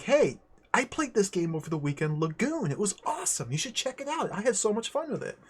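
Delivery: high, gushing retold enthusiasm; imitated excited kid. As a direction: act out a kid raving to a friend. Retells his kid self enthusing.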